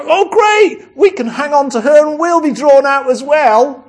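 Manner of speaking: high, loud, gleeful exclaiming; high, loud gleeful hangers-on; gleeful crowd. Acted. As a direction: cheer like you've found a free ride. People cling on gleefully.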